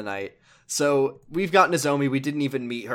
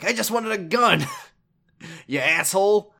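angry buyer; 6 semitones higher, 4 dB louder than usual. Retold or imitated. Angry buyer yelling at dealer.

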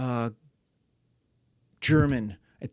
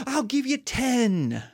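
generous rich patron; 7 semitones higher, slower than usual. Acted. Rich guy's generous offer.

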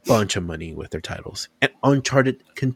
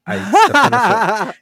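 high-pitched, louder retold admission; laughing confession; 11 semitones higher, 6 dB louder than usual. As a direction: crack up while owning up. Sheepish retold answer, cracking up.

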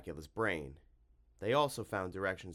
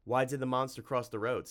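sharp, louder, higher challenge; brisk, raised-voice snap; snapped; 5 semitones higher, 5 dB louder than usual. A narrator's reading. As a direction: snap the riddle out like a threat. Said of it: "snapped Tim".

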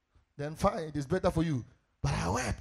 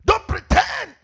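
insistent, raised pulpit delivery; impassioned preaching; 9 semitones higher, 8 dB louder than usual. Real, not acted. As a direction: build in driving waves, phrase upon phrase. Sustained preaching at full force.

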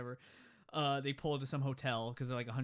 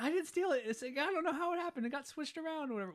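high-pitched, hurried excuse; mock defensive protest; 14 semitones higher than usual. Companion denies taking the card, mock defensive protest. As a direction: imitate squeaky pleading innocence. Acted denial of theft.

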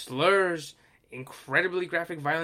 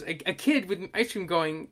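ordinary talk

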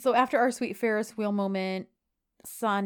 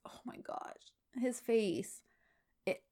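slow, real whisper, barely voiced; overcome whisper; 3 semitones higher, 6 dB quieter, slower than usual. Real, not acted. To her co-host about John's face, a slow real whisper. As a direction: hush it as if it hurts to say.

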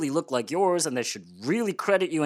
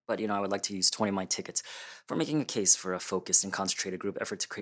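low, fast, even recital; rapid formal pitch; 8 semitones lower, 5 dB quieter, faster than usual. Acted. [name] spends tickets to make his case, rapid and formal. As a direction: rattle off the proposal low and quick.

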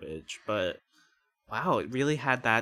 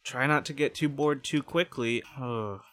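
ordinary delivery

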